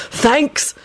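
high, fervent call. A narrator's reading. Freed Benji thanks his captors, fervent. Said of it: "called out fervently".

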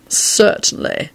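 slow, drawn-out disdainful voice; snapped retort. A narrator's reading. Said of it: "snapped a voice from above".

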